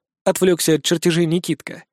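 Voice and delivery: ordinary delivery, nothing marked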